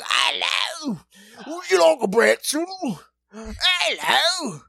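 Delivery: high, put-on faire vendor calls; hawking wares. Performers hawk pretzels to the crowd, hammed-up vendor voice. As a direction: imitate an in-character hawker calling out. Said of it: "just trying to hark, hawk their wares".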